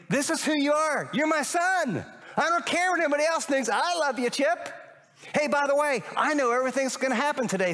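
high, bright reassuring voice; God's voice. Retold or imitated. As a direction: speak as a loving father, lifted up.

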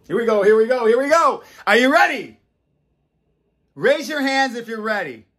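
high, loud, building crowd call; rallying hype. Real, not acted. Rousing everybody for a new life, excited. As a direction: whip the audience up, voice climbing. Hyping up the audience to start.

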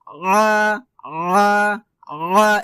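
আ as a pressed ع from mid-throat; drawn-out pharyngeal আ; correct ayn. Shown as a demonstration. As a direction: squeeze the throat on a long আ. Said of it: "এভাবে try করেন".

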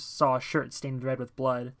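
ordinary delivery

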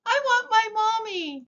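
high little voice wanting mommy; distressed cry. A narrator's reading. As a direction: cry out like a small lost child. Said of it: "cried the very small".

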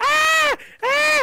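high, shrill imitated screaming; mock screams. Retelling the screams he heard, mock screaming. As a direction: squeal like people upstairs. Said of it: "hear the screaming from above me".

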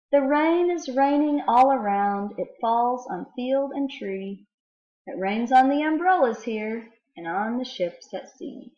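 mysterious, teasing read-aloud that slows at the end; riddle poem reading. Acted. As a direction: let the riddle hang for the children to guess. Reads Stevenson poems aloud.

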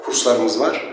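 ordinary talk

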